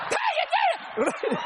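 very high, slow, drawn-out falsetto squeak; imitating the man going down the prayer line, high, slow and squeaky; mock squeaky praise. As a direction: stretch it out high and thin as he goes down the line. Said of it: "Just going down the line".

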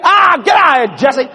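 high, loud brush-off; older brother shouting the kid away; shouting big brother. Retold or imitated. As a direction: yell at the pest. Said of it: "He said".

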